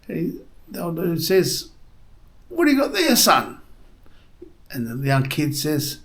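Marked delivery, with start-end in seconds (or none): 2.5-3.6 put-on old codger's call, pitched way up; high old man voice. Old man on porch calls to boy, high voice. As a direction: squeak it out like a nosy old man.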